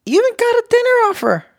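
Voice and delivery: high, fast disbelief; incredulous. Real, not acted. Co-host marvels he offered dinner, incredulous. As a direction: squeak it out in disbelief.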